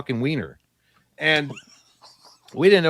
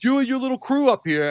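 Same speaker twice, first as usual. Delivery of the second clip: retold angry accusation; 11 semitones higher, 4 dB louder than usual. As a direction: imitate a worked-up guy picking a fight. Same retold accusation continues.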